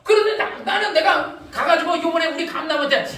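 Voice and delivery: ordinary talk, nothing marked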